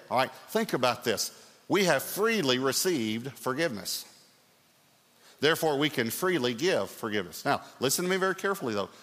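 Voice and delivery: normal talking voice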